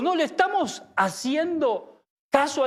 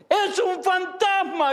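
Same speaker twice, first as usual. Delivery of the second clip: high, slow frightened shout of a ghost sighting; terrified cry; 8 semitones higher, slower than usual. Acted. Disciples see a figure on the water, terrified high cry. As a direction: cry out in terror, drawing it out.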